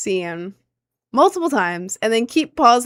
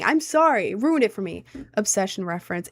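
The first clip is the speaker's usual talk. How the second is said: normal talking voice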